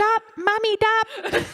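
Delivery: high, loud squeaky plea; hammed-up high-pitched mimicry; mocking "dop" echo. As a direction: join the bit through laughter. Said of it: "he'd be like".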